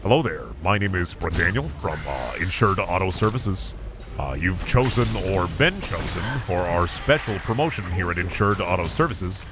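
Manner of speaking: low, smooth telemarketer pitch; car warranty salesman. Pretend. Salesman pitching a fake car warranty to friend, low and smooth. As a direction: sell him the warranty like a scam caller. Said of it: "sound like you're about to sell me an extended warranty".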